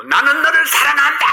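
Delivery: high, loud call across the mountain; mother calling from the mountaintop. Retold or imitated. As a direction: shout the love out to the hills. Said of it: "이렇게 외쳤습니다".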